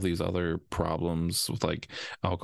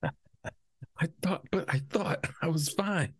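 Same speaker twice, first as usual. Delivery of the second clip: whiny client; 10 semitones higher, slower than usual. Pretend. Playing confused client.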